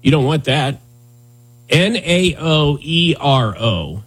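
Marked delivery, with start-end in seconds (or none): 1.7-4.0 N A O E R O spelled out letter by letter, slowly; host spelling the new name slowly to his co-host. Shown as a demonstration. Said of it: "five consecutive vowels".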